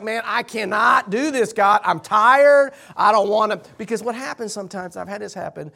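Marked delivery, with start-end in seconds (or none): 0.0-3.6 frustrated. Retold or imitated. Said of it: "And I'm just like".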